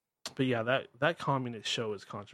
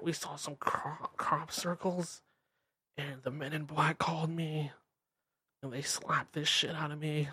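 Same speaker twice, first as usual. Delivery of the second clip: lampoon a rambling chain-smoking guest; higher mocking impression of a spaced-out radio guest; mocking Linda Moulton Howe; 4 semitones higher than usual. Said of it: "talking like this".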